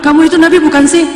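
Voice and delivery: a jealous wife snapping back at her husband in a sulk; sulky snap. Retold or imitated. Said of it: "Saking Aisyah nggak ngambek".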